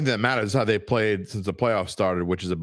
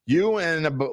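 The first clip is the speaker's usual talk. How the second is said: normal talking voice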